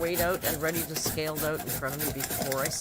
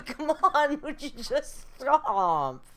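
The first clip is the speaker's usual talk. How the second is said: loud, slow, laughing scold at the cat; half laughing, half fed up, tell him off; exasperated scolding; 9 semitones higher, 10 dB louder, much slower than usual. Real, not acted. Scolding cat biting knee.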